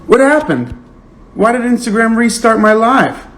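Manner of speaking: high-pitched, exasperated questioning. Real, not acted. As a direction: demand an explanation, voice pitched right up. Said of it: "I am so mad".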